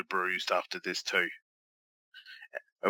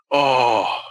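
pained groan; 4 semitones higher, 11 dB louder than usual. Real, not acted. Wincing at a forearm shot.

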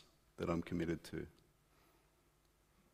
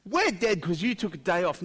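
high, loud mock protest; mock complaint; 9 semitones higher, 6 dB louder than usual. Preacher mimicking martyrs blaming God, mock complaint. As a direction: protest loudly, hammed-up accusation. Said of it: "No, they're like".

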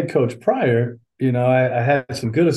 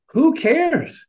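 high-pitched, dismissive exclamation; 13 semitones higher than usual. Real, not acted. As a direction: wave it off with a high-pitched "who cares". Waving away career statistics.

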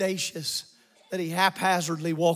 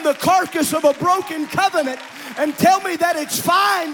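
raised, driving preacher's voice, building higher; full-force preaching; 7 semitones higher, 7 dB louder than usual. Acted. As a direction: build to a high, forceful exhortation.

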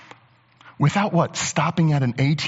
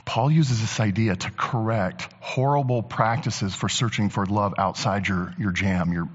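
usual speaking voice

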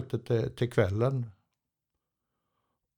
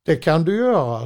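higher, louder quote of the German staff; loud, raised imitation of the German workers; quoting the Germans; 5 semitones higher, 8 dB louder than usual. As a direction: replay their answer higher and louder, mock-firm. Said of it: "De pratar tyska".

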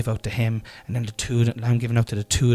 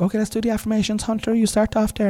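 put-on singsong higher coaxing to the kids; chirpy, fast, higher encouragement; dad starting the morning affirmations in a bright higher voice; higher, cheery dad voice; 11 semitones higher, faster than usual. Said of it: "I was like".

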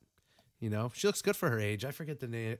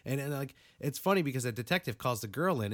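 normal talking voice

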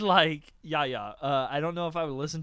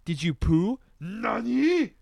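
high, loud anime dub voice; overacted anime dub; 6 semitones higher, 6 dB louder than usual. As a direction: ham up an anime character. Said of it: "That sounds like an anime thing".

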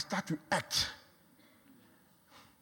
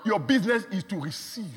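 preaching at full force; 5 semitones higher, 5 dB louder than usual. Acted. Preacher assuring the church God brings it, full force. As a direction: drive the promise home to the crowd.

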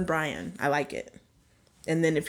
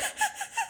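Snoopy laugh; 10 dB quieter than usual. Shown as a demonstration. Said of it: "Snoopy that laughs like that".

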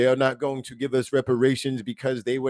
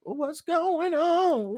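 much higher, very slow, drawn-out wail on What's going on; mock falsetto wail; much slower than usual. Shown as a demonstration. Host echoing cohost's inflation gripe, high, slow mock wail.

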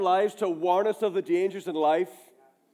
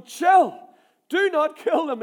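firm command; 6 semitones higher, 6 dB louder than usual. A narrator's reading. Elisha calms eager king.

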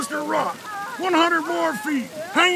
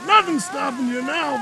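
ordinary talk, nothing marked

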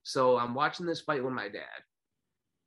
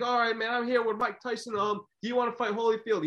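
mock interviewer; 10 semitones higher than usual. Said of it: "He was like".

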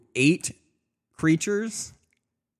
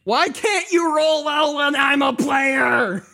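high, loud exasperated yell; frustrated shout; 11 semitones higher, 5 dB louder than usual. Real, not acted. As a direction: shout it at the bad dice.

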